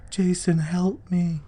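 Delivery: high, drawn-out imitated cry for help; put-on high voice, words stretched long; woman calling out. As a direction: mimic a woman's slow, high, eerie call. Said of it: "sound of a woman calling out".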